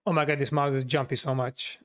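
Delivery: ordinary talk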